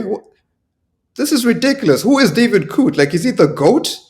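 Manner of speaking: ordinary talk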